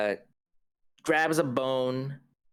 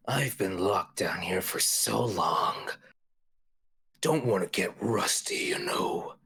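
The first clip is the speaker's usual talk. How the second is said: hungry monster whisper. Acted.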